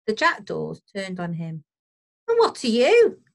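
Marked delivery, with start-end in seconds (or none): 2.3-3.2 high, loud indignant question; affronted jackdaw, pitch raised; oldest jackdaw challenging. A narrator's reading. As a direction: demand it of a stranger bird. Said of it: "asked the oldest jackdaw".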